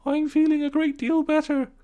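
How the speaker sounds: high, weak put-on voice; feeble washerwoman voice. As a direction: sound frail but grateful. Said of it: "said Toad in a feeble voice".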